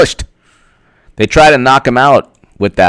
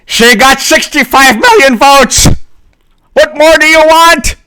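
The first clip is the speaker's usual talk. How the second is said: mock angry yell; 14 semitones higher than usual. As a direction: yell it like an outraged poster. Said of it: "why are you yelling at me".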